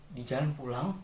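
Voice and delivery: plain reading voice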